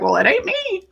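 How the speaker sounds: high, laughing comeback; cheeky laughing answer; laughing retort. Retold or imitated. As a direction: toss it off with a laugh. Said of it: "I was like".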